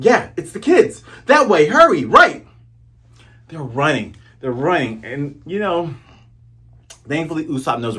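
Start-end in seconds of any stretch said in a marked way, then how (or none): 0.0-2.4 urgent call. Retold or imitated. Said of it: "Cut to Zoro and Usopp".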